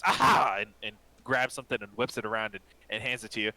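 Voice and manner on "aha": louder, higher eureka shout; triumphant aha. Acted. The apothecary finds the potion and exclaims in triumph. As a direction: crow it like he finally found it. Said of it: "whips something and goes".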